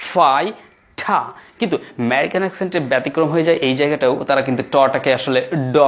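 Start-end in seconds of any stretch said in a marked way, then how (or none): 0.1-1.2 ফাই ঠা split in two, aspirated t; British pronunciation. Shown as a demonstration. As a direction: say ফাই ঠা with a pause and no r. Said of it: "টটাকে ঠ করা হয়".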